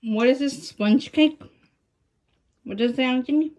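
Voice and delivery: talking while chewing; mouth full. Real, not acted. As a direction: food at the lips. Seen 0: Eats dark snack stick while talking; cake is held by another person.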